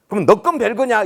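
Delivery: loud, high retold retort; angry outburst. Angry father snapping back at the official, loud mock rage. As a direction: imitate an irate father blowing up. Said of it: "화를 버럭 내는 거예요".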